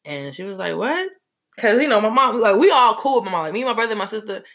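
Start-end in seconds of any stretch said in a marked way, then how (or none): 0.8-1.1 high, rising "what?"; mother's higher-pitched questioning reply, retold. Mother answers her child's announcement, questioning. Mother reacts to coming news.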